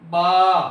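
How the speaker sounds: "با" said loud and clear with an open a, not "بے"; Arabic letter name. Shown as a demonstration. Teacher calling out the letter "با" for the child to repeat, loud and clear. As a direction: sound out "با" as a full open ba. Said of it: "پڑھنے میں ہم با پڑھیں گے".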